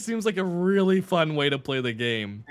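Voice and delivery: loud and eager, really into it; excited. Real, not acted. Enthusiastic about Zonai-only run.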